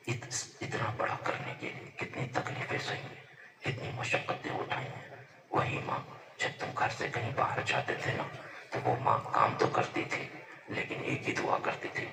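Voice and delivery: ordinary delivery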